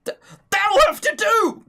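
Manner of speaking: very high, loud frantic squeal; desperate bald man. Retold or imitated. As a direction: settle for it in a panicky, high-pitched yelp. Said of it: "then desperately needed hair and went".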